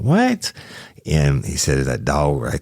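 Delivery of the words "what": sharp high disbelieving yelp; a retold jolt of disbelief; shocked what. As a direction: blurt it out in sudden shock. Shocked reply to dog attack news.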